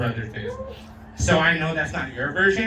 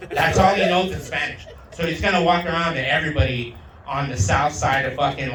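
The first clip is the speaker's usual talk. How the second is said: normal talking voice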